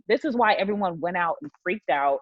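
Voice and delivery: ordinary talk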